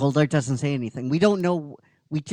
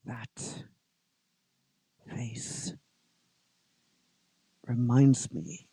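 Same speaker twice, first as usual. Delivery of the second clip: very soft, slow recollection; faint wondering hush; hushed memory; 3 semitones lower, 5 dB quieter, much slower than usual. Retold or imitated. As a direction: murmur it as it dawns. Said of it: "Goldar remembering".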